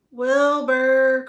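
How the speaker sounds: loud, high call of a name. Acted. Elmer calling for his hidden cousin Wilbur, loud and high. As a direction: call across the trees. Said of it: "called Elmer".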